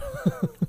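squealing laugh; real laugh ending the story; high burst of laughter. Real, not acted. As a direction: crack up at the punchline.

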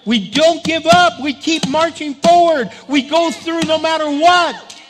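loud, high, rousing exhortation; rally the congregation at the top of your voice; impassioned preacher's shout; full-force preaching. Real, not acted.

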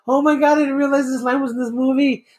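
high, delighted gush; excited discovery. Retold or imitated. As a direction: gasp it out like a thrilled fan. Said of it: "I was like".

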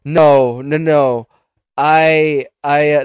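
loud, emphatic no no no; loud protest. Real, not acted. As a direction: shut down the wrong guess loudly. Rejects robot's wrong guess.

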